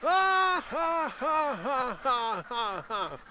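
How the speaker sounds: high, booming belly laugh that trails down; imitating a big happy Buddha laugh. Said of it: "They sound like a laughing Buddha".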